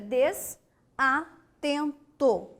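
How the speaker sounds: "des-a-ten-to" slowly, pausing at each break; syllable by syllable. Shown as a demonstration. Teacher repeating the split under question, slow detached syllables. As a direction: dictate "des-a-ten-to" piece by piece. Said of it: "Se eu separasse desatento desta maneira".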